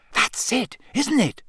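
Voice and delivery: high, eager burst; sudden realisation, voice jumping up; thrilled and high-pitched; excited. A narrator's reading. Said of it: "said Harry excitedly".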